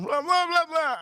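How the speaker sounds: high-pitched imitating gibberish, no real words; mock foreign babbling. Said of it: "That's all I heard, man".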